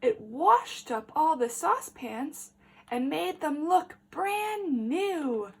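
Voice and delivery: ordinary delivery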